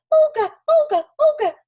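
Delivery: very high, loud gurgling fish call; high gurgly voice. A narrator's reading. Stranded fish calling after Olga, high and gurgly. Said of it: "in a high, gurgly kind of voice".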